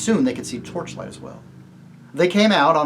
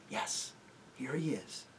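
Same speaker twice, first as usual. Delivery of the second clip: whisper; 16 dB quieter, slower than usual. Acted. Whispering in the dark.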